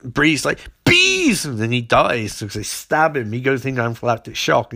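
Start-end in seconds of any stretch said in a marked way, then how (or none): 0.8-1.5 very high, hammed-up shriek; mock villain cry. Bee woman setting bees on a victim, shrieking theatrically. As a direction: send the word shooting up high.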